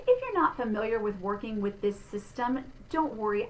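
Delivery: ordinary talk, nothing marked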